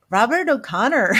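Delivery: attempted Irish accent, playfully unsure. Medium trying out the Irish name she hears, amateur Irish accent. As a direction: have a shaky go at an Irish accent. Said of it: "in a very thick Irish accent".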